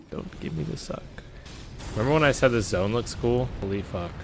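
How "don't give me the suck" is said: breathy whispered plea; real whisper. Real, not acted. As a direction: hush it to yourself. Whispered aside.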